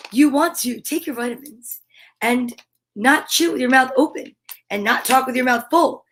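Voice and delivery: ordinary delivery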